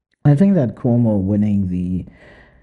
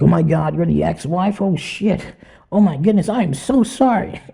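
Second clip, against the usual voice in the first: high, gushing fake apology; mock awe; 6 semitones higher than usual. Host playing staff floored by the ex-wife, mock awe. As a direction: overdo the shock, climbing higher into apology.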